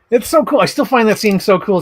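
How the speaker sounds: loud, high burst of delight; excited gushing. Real, not acted. Collector gushing over his new John Carver figure, loud and high. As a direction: gush like a fan holding the figure.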